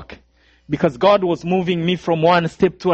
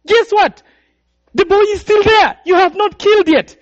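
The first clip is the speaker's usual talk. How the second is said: much higher, loud shouted alert; alarmed shout; 13 semitones higher, 4 dB louder than usual. Retold or imitated. Third killer shouting that the boy is still there, high and loud. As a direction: call out in disbelief.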